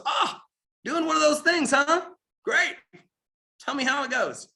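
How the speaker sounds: a know-it-all neighbor sneering over the fence; sarcastic dig at a newbie; mocking neighbor. Skeptical neighbor mocks new business.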